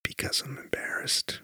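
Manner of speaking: whispering. Real, not acted. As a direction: hush it down. Both whisper about embarrassment.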